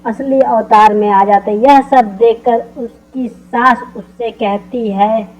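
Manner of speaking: plain reading voice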